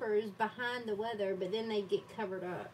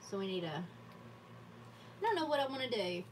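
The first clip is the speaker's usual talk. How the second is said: ordinary delivery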